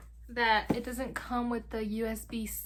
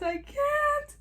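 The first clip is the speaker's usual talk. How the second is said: high squeak; 4 dB louder than usual. Real, not acted. Overwhelmed excitement.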